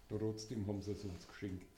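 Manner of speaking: ordinary talk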